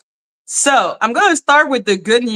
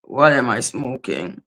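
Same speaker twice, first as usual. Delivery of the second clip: low mock disbelief; 11 semitones lower, 5 dB quieter than usual. Real, not acted. Self-mocking disbelief.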